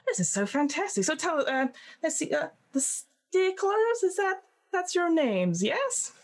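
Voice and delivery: high, slow gushing editor voice. Acted. Editor Corey fussing over the team name in a high coo.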